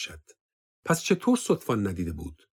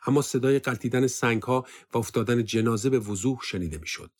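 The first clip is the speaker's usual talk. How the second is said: ordinary delivery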